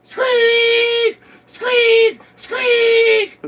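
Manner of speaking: very high, loud squealing squeaks; squeaky horn voice. Pretend. Co-host repeating the squeak on request, very high and loud. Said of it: "Do it again".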